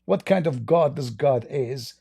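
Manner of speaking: ordinary delivery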